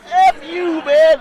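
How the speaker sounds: high, loud comic retort; hammed-up holler; mock defiant shout. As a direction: blurt it out as a yelled brush-off. Acting out the reply again.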